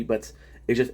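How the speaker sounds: ordinary delivery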